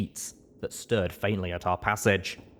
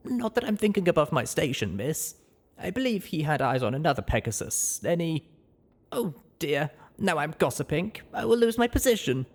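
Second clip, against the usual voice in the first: higher servant voice, hurrying at the end; flustered maid voice; 8 semitones higher than usual. Acted. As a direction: catch herself gossiping, flustered.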